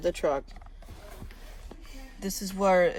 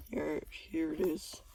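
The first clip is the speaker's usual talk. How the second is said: whisper; 8 dB quieter than usual. Real, not acted. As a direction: whisper it softly. Hiding under truck.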